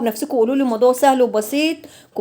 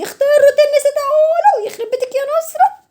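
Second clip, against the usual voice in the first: much higher squeal, laughing at her own mistake; laughing shriek at herself; 3 dB louder than usual. Real, not acted. Suddenly remembers the routine. (صرخة ضحك عالية على نفسها)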